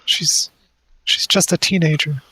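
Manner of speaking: high, slow, tearful begging; pleading. Acted. Mother grabs a stranger by the collar, pleading. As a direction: plead desperately in a high weepy voice. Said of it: "like, pleading".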